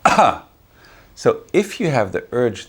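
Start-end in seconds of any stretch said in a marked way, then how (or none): 0.1-0.4 sudden explosive cough-like "ah"; short, loud mock cough; cough demonstration. Shown as a demonstration. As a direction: expel the air in one sharp burst. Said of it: "suddenly you expel the air".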